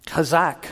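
chazak with a guttural Hebrew ch; chazak, careful Hebrew sounding; Hebrew pronunciation. Shown as a demonstration. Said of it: "The Hebrew word".

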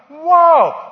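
big high, loud wow; hammed-up astonishment; mock amazement. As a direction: cry out in pretend wonder. Mock amazement at hand obeying command.

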